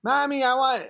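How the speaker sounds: high-pitched mock child voice; whiny little girl imitation. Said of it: "girls would be like".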